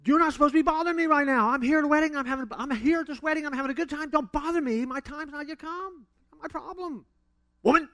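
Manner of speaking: very high, hammed-up indignant scolding; mock rude rebuke. Preacher parodies Jesus scolding his mom, exaggerated and rude. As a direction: play an affronted son telling mom off, loud at first. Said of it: "looking back at his mom and going".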